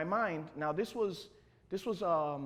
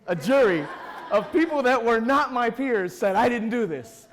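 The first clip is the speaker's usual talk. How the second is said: building to a loud, raised-pitch declaration; proud defiance; 7 semitones higher, 12 dB louder than usual. Retold or imitated. Applicant defending his acquittal to interviewer, proudly defiant. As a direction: proclaim your innocence with swelling pride. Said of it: "you know that pride of".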